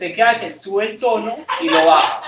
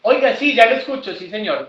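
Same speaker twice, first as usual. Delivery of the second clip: loud, high matching reply; 4 semitones higher, 6 dB louder than usual. Shown as a demonstration. An agent matching the caller's loud tone first. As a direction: start at the caller's loud level. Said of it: "usted empieza el tono".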